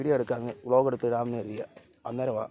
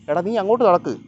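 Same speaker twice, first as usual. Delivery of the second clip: raised, higher-pitched shout; calling the dog; 6 semitones higher, 6 dB louder than usual. Real, not acted. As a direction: bark an order at a stubborn dog. Urging the dog along.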